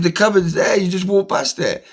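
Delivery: high, loud, incredulous complaint; pointing out the obvious, fed up; exasperated. Retold or imitated. Retold row with the orderly.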